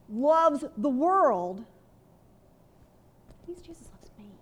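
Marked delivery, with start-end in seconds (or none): 1.6-4.3 whisper. Acted.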